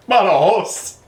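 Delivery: high, loud Spanish "ba-da-hoss"; Spanish accent. Retold or imitated. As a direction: mimic the local saying Badajoz. Mimics the conductor's Spanish pronunciation.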